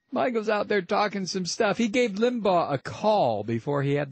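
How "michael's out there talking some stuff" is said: ordinary talk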